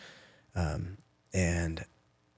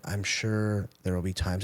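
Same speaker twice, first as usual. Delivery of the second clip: normal talking voice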